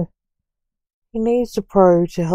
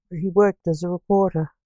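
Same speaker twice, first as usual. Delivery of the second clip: ordinary delivery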